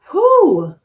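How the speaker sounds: high loud breath out; overwhelmed whew. Real, not acted. Reacting to the upheaval card, high whew. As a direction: blow out a high whew of emotion.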